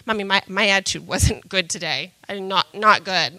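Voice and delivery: mother imitating her son's apology, soft and sheepish; little son. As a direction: say it small, like a contrite child. Mother voicing her young son.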